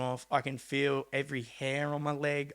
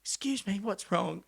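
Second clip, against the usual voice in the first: higher, lightly voiced old lady; a higher little old lady asking a worried question; 7 semitones higher than usual. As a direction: do the old lady who comes out. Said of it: "This little old lady toddles out".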